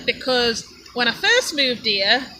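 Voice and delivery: ordinary talk, nothing marked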